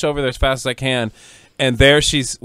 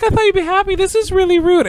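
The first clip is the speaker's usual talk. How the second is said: very high, loud offended whine; imitated hurt indignation; 5 dB louder than usual. Imitating friend mad at ungrateful daughter, offended squeal. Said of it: "she gets mad at me".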